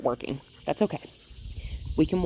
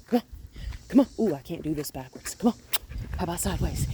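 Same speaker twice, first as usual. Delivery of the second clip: ordinary delivery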